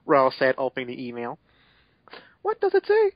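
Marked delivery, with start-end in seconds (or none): 2.4-3.1 squeaky high falsetto; silly falsetto voice. Acted. Narrator voicing the character in a squeaky falsetto, joking it's his male voice. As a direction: pitch way up for comic effect.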